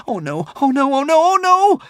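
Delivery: high, frantic, climbing oh nos; panicked boy. Acted. Hiccup hopping from the dragon, high terrified wail. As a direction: squeal it out in rising terror. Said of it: "screamed the hopping Hiccup".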